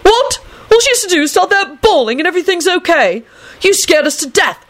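high, exasperated outburst; incredulous high-voiced tirade; screaming. A narrator's reading. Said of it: "Neville screamed".

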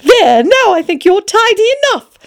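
put-on high, excited girl's voice; Alice crying out. A narrator's reading. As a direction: chide playfully in a high little-girl voice. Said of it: "she cried out".

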